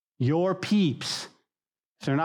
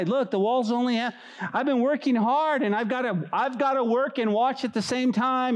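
higher, whining worker griping about the wall; whiny complaint; 8 semitones higher than usual. Retold or imitated. Tired wall builder complaining to a friend, high and whiny. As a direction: moan about working and watching at once.

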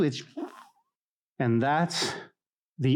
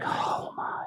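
hushed dismay; 6 dB quieter than usual. Acted. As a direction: breath-only whisper of dread. Awed reaction imagining life without God.

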